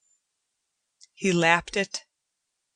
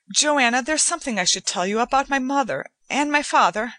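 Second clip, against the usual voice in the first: rushed and fast, higher; impulsive blurt; 7 semitones higher, 4 dB louder, faster than usual. A narrator's reading. Etienne blurts out his confession to Joanna, fast and rushed. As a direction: let it spill out in a hurry. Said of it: "I let the impulse take possession of my speech".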